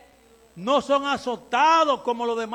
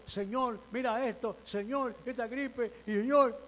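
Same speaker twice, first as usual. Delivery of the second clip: much softer, whimpering plea; mock tearful prayer; 8 dB quieter than usual. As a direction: play a pitiful sick believer praying. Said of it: "Y uno llorando".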